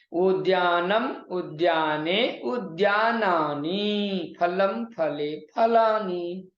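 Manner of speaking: drawn-out declension drill with held endings; slow recitation. Shown as a demonstration. Teacher reciting noun forms for students, slow drill. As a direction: recite each form slowly and evenly.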